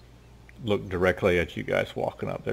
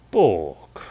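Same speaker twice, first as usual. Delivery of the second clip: louder single grunt; grunted name; 7 dB louder than usual. Acted. Cher answers Bork with a single grunt. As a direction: bark the one word. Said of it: "that's all Cher says".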